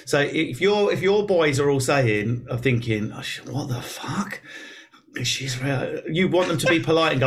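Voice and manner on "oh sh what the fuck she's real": much softer, slow, hushed disbelief; muttered slow shock under the breath; hushed shocked aside. Retold or imitated. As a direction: drop right down, slow and appalled. Friends' shocked private thought.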